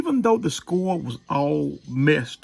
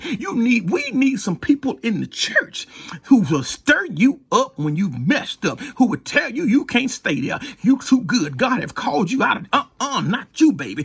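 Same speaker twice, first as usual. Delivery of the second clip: preaching; 7 semitones higher than usual. Acted. Preaching build.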